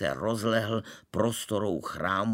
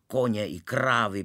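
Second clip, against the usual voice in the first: usual speaking voice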